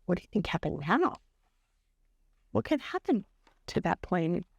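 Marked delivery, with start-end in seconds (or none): none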